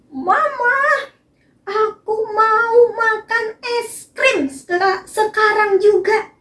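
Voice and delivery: put-on high, sing-song child begging; child asking mama. Child begging mama for ice cream now, high and sing-song. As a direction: beg like an impatient little kid. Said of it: "dia bilang kayak gini".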